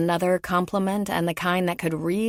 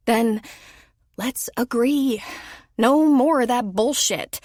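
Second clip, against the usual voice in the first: higher, louder, emphatic; Lola laying down terms; 5 semitones higher, 5 dB louder than usual. Acted. Lola insists to Tom: no more bullshit, loud and firm. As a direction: slam the door on the sweet talk.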